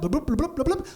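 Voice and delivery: rising bloop notification noises; imitated email alerts.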